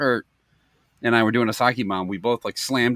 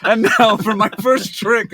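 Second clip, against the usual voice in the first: high, loud, slow showman's call; hammed-up chef announcer; 11 semitones higher, 6 dB louder than usual. Chef introducing his bawdy trick, hammed-up showmanship. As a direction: announce a trick through laughter.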